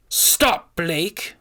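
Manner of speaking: loud, high, stripped of sweetness; harsh command. A narrator's reading. Witch threatening Blake with the knife, loud harsh order. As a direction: bark it with all pretence gone. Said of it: "veil of sweetness now completely removed".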